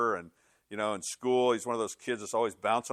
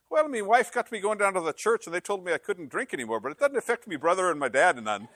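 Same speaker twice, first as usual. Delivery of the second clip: high Irish brogue building to a punchline; high, put-on joke Irishman's brogue; 7 semitones higher, 3 dB louder than usual. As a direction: explain it innocently in an Irish brogue. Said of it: "I gotta say this in a brogue".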